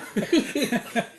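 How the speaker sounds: high burst of laughter. Real, not acted. Laughing at living room joke.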